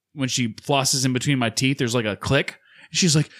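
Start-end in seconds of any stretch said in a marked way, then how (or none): none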